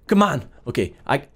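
ordinary delivery

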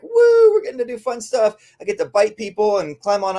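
high, whooping mock dog glee; excited dog. Trainer voicing her dogs eager to work. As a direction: cheer like a thrilled dog. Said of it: "they're like".

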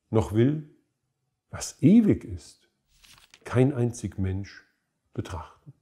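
weighty verse reading, pauses between phrases, falling away at the close; solemn poem recitation. Acted. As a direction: declaim a Baroque lament in swelling, widely pitched phrases. Trägt Barockgedicht vor. (getragen deklamiertes Barockgedicht)

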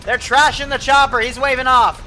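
loud, high alarmed callout; urgent shout. Real, not acted. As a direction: call it out in alarm. Alarmed callout during attack.

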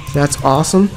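ordinary talk

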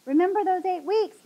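high, loud gloating taunt; chuckling. A narrator's reading. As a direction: sneer it with a chuckle. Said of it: "Mister Smith chuckled".

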